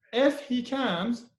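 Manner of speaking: high, slow, emphatic "If"; stress on IF. Shown as a demonstration. Teacher repeating the example, high stressed "If". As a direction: hit "If" high, then rise on "comes". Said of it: "you put a stress on if".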